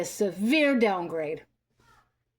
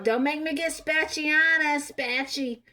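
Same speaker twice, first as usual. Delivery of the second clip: higher, sing-song mocking of her threat; mocking quote; 6 semitones higher, slower than usual. Imitating Olivia threatening her kid, taunting sing-song. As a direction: parrot her silly threat in a taunting lilt. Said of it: "and talk about".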